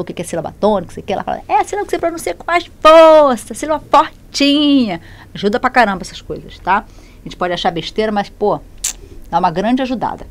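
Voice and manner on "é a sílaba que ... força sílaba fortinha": high-pitched imitation of a primary teacher; schoolteacher auntie. Auntie teaching stressed syllables to children, put-on high teacher voice. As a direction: explain it like auntie teaching little ones. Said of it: "que a titia lá, quando te ensinou".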